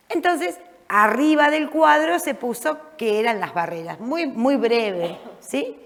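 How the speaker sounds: normal talking voice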